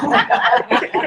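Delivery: a breathy, laughing oh my God; awed gasp. Retold or imitated. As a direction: gasp it in awe. Awed by intimidating guests.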